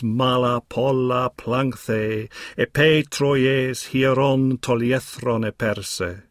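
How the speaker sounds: slow, measured chant of the Greek lines, each syllable weighed; Greek hexameter recitation. Shown as a demonstration. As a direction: read Homer aloud like a bard, slowly. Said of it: "I'll now just read the Greek through".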